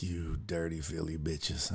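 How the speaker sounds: hissed whisper under the breath. Retold or imitated. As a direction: curse them in a secret whisper. Quietly cursing the passengers.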